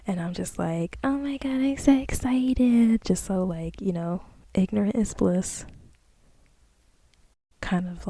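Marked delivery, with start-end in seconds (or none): 1.0-3.0 giddy excitement. Retold or imitated. Giddy in the dream.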